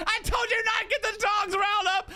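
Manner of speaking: high shouted mock scolding; scolding wife. Wife scolding him over the dogs, high mock yell. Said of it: "She's like".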